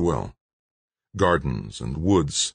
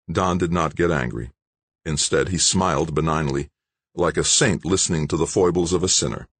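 ordinary delivery

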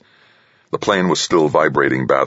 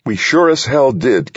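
higher, with a slight tremble; slightly trembling voice; 10 semitones higher, 4 dB louder than usual. A narrator's reading. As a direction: triumph with a slight quaver. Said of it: "her voice trembling slightly".

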